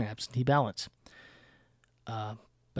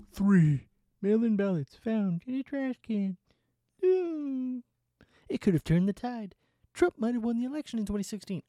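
sarcastic mock alarm; 9 semitones higher than usual.

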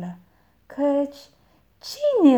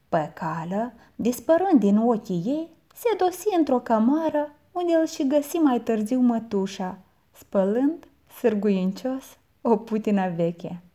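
plain reading voice

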